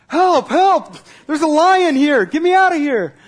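high, loud terrified shouting; panicked yell for help. Retold or imitated. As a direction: scream for help like you're face to face with a lion. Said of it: "he yells".

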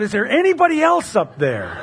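high, acted call upward; yelled out. Hiker rejecting God's advice, yelling out for someone else. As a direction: yell it out as a punchline. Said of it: "and yelled out".

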